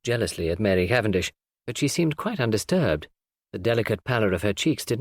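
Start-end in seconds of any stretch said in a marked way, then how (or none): none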